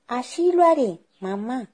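put-on high-pitched child voice; little girl. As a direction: reassure mum as a small girl. Girl answers her mother.

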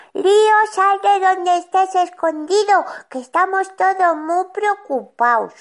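imitating a worried mother shouting; imitated yelling. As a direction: call out to a hidden child. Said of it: "oigo a mi madre que chilla muy fuerte".

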